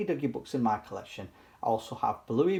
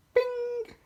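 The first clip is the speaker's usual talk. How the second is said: much higher ding; oven bell. Acted. The pie is ready, high oven bing. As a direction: ring it like a timer.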